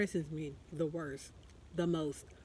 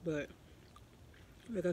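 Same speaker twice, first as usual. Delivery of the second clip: chewing while talking; talking around food; mouth full; 8 dB quieter than usual. Real, not acted. Seen 1: Spoonful at -3s; pursed, chewing lips continue into early words.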